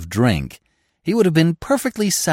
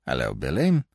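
low, gentle greeting close to the ear; soft-edged, lower voice, rising on the name; 8 semitones lower, 3 dB quieter than usual. A narrator's reading. Officer surprises pickpocket in the crowd, gentle. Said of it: "a gentle voice breathed into his ear".

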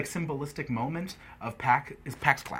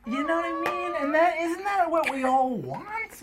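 mock-dramatic high voice; 13 semitones higher than usual. Mock-sentimental grand statement.

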